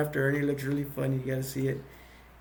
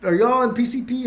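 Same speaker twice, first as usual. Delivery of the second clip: higher, louder mock alarm; retold scared; 8 semitones higher, 5 dB louder than usual. Man with a gun confronts the newcomers, mock scared. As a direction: imitate a rattled man challenging strangers. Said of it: "Ernie's all scared".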